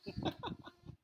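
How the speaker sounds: a light squeaky laugh; soft high giggle. Real, not acted. The reader laughing at a small animal, soft high giggle. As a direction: giggle fondly.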